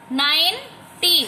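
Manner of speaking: "Ninety" slowly, gap after nine; teacher sounding out "ninety" in separate parts; syllable split. Shown as a demonstration. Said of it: "बोल के repeat करके देखना है".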